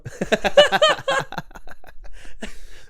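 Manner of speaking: high squealing laugh; burst of laughter. Real, not acted. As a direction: crack up helplessly. Host cracking up at the story.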